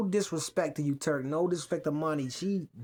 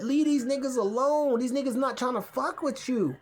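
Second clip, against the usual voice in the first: ordinary delivery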